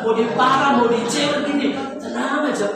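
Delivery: preaching at full force. Acted. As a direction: call it out over the congregation.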